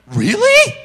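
very high, loud rising question; amazed squeal. Retold or imitated. Woman hearing that it really is Him, amazed squeal. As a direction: imitate an astonished squeal.

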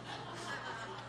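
a high, soft laugh near tears; tearful laugh. Real, not acted. Laughs at his own tears.

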